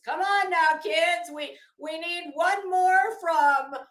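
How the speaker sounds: pep the troops, hammed up, slowing at the end; mock rallying. Host rallying her viewers to call in, mock pep-talk shout.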